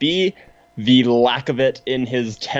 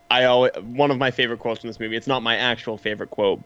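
normal talking voice

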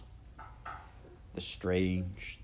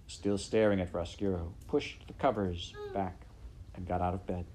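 usual speaking voice

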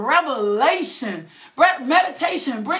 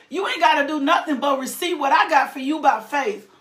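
ordinary talk

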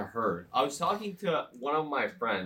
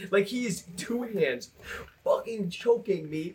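ordinary talk

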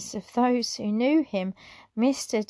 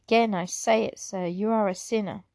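ordinary delivery, nothing marked

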